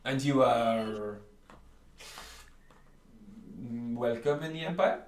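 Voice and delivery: a fussy shopkeeper warning customers off his stock. Acted.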